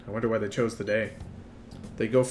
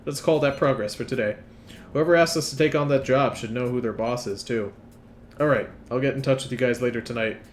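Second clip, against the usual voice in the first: ordinary talk, nothing marked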